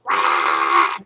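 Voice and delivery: very low growling mock roar; mock monster death cry; imitating Enderman death scream. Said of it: "the Endermen when they die and they're just like".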